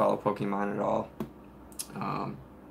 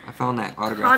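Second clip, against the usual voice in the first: excited; 12 semitones higher, 4 dB louder than usual. Real, not acted. Excited find on eBay.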